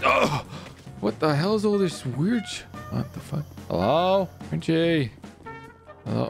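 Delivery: usual speaking voice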